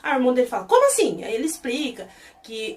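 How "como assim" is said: high, loud "Como assim?"; shocked. Retold or imitated. Brother hears he's dating, shocked and loud. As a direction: brother can't believe it.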